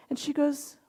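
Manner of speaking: ordinary talk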